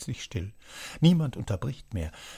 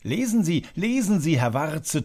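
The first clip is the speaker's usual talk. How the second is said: higher, louder eager urging; clamouring crowd; 6 semitones higher, 4 dB louder than usual. A narrator's reading. The whole tavern urges Warze to read, eager clamour. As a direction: everyone begging him to read. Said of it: "tönt es von allen Seiten".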